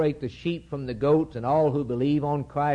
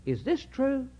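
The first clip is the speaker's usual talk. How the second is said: serpent's taunting question; 9 semitones higher than usual. Acted. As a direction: lure her with mock astonishment. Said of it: "Is what he's saying to her".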